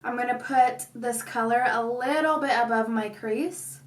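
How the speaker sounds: ordinary delivery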